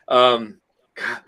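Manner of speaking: talking through hiccups; words broken by hiccups. Real, not acted. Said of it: "these hiccup, I cannot get rid".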